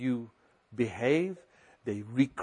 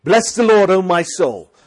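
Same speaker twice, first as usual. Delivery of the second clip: higher, louder exhortation to himself; speaking to your soul; 4 semitones higher, 10 dB louder than usual. Shown as a demonstration. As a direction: rouse your own soul. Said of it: "Talk to your soul that's in there and say".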